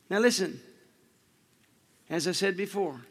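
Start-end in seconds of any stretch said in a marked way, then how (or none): none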